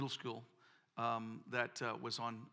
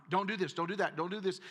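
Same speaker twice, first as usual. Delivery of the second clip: higher, louder nagging rules; scolding church voice; 5 semitones higher, 5 dB louder than usual. Retold or imitated. Preacher imitating church elders forbidding everything, nagging and loud. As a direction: rattle off the forbidden list like a scold. Mimicking church's list of prohibitions.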